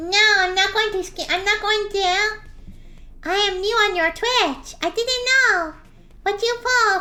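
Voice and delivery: slime voice changer. Acted. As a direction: play the cheerful slime character through a voice changer. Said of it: "you're gonna hear my voice change".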